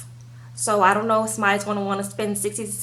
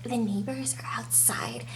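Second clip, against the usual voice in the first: whisper; 10 dB quieter than usual. Retold or imitated. Lacey warning Shane indoors.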